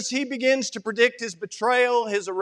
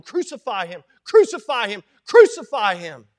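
acted mob chant, swelling louder; mob shouting; 6 dB louder than usual. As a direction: build from a held-back call to a loud cry.

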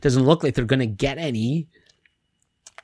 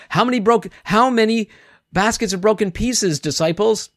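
scolding teacher; 7 semitones higher than usual. Retold or imitated. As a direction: quiz them like a stern teacher who knows they know. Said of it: "He's, he's scolding them".

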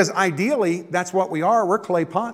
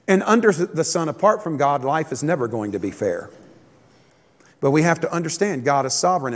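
normal talking voice

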